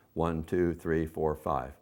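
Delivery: "One, two, three, four, five" counted quickly and lightly; fast count. Shown as a demonstration. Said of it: "you count faster".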